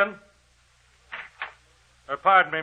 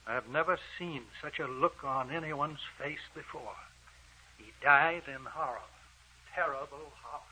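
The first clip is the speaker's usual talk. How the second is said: hushed; 13 dB quieter than usual. Acted. Doctor shaken by corpse.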